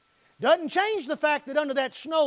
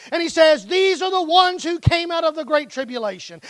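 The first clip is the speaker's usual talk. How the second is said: preaching at full force; 6 semitones higher, 8 dB louder than usual. Acted. Preaching run.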